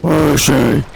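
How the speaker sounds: loud demon growl. Acted. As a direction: demand it as a demon. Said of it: "How do I want to do his voice?".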